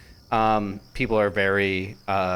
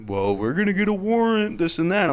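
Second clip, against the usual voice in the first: mock police officer; 8 semitones higher than usual. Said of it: "they're like".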